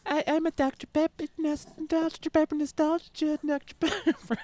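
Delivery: high-pitched mocking impression; Emily. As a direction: put on a squeaky voice to tease her. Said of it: "That's my Emily impression".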